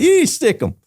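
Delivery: high, loud whooping cheer; mock cheer. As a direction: whoop it up like a rodeo fan. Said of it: "I go".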